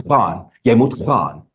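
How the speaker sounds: "Gaan" with the g made at the back of the throat; back-of-throat Afrikaans. Shown as a demonstration. Actor showing interviewer how Afrikaans sounds, throaty g in "gaan". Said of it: "back of the throat sound".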